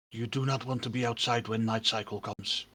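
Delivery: low, hushed word of warning; ominous warning. Acted. Game master as bystander warning newcomers about night, ominous. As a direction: drop the voice low and soft, gravely serious. Bystander warns players of danger.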